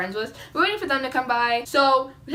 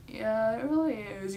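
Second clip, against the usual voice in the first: soft, sleepy, unenthused reply; acting too tired to care; unexcited; 8 dB quieter than usual. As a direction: agree quietly with no excitement. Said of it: "You don't sound excited".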